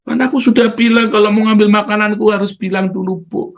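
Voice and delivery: put-on high, insistent child's voice; acted-out small child's scolding; Miracle. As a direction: remind mum of the rule like a firm toddler. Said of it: "dia belajar menerapkan itu".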